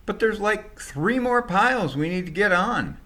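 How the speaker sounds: high, loud protest; shouting back. Acted. Packers shouting back at the driver over the piles. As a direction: yell back in frustration. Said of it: "they shouted back".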